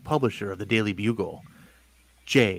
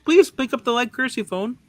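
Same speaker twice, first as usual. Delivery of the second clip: phone ring; 6 dB louder than usual. Acted. Phone ringing, then announcement.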